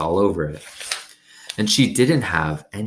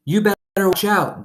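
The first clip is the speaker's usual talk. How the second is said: lightly voiced boy calling out, high; a hint of a kid hollering a warning; mild high-pitched warning; hollered warning; 14 semitones higher, 3 dB louder than usual. A narrator's reading. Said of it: "Dunlap hollered".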